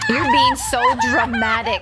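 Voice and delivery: very high, loud scolding; mock shout. Streamer mocks screaming character with a loud shriek. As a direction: shriek it back mockingly.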